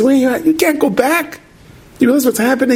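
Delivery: high-pitched urgent retold protest; alarmed protest. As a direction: plead in disbelief, voice shooting up. Acted friend pleads against going back.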